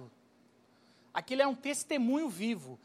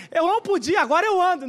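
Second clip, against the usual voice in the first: much higher and louder, overjoyed healed man; pun called out; 6 semitones higher, 9 dB louder than usual. Acted. Healed man throws his pun at the priest, joyful shout. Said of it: "Olha o trocadilho".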